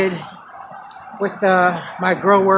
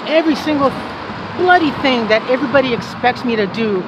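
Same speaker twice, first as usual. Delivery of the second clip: emotional outburst; 5 semitones higher than usual. Real, not acted. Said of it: "I'm so emotional".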